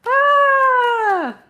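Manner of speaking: loud, high held "ah"; long high cry. Pretend. Tarot reader fed up with this person, high held cry. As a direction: let out a drawn-out mock scream. Exasperated cry during the reading.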